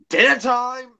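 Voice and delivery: loud, high theatrical cry; John Sim. Acted. Attempting John Sim's catchphrase, high shouted impression. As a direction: belt it out in a hammed-up impression. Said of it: "I can't do John Sim".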